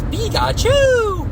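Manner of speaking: high, drawn-out Pikachu call; Pikachu cry. Retold or imitated. Player mimicking Pikachu's attack in the game, high drawn-out cry. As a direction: squeal it out, stretching the last syllable high. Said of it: "Where he goes".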